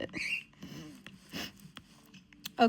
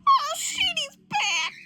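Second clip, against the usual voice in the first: very high mock alarm; startled squeal; 3 dB louder, slower than usual. Spotting that he is back, startled squeal. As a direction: squeal it in hammed-up shock. Acted reaction to a return.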